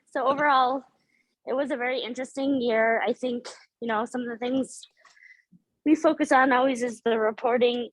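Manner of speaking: out of breath. Real, not acted. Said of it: "I'm out of breath running from the garage".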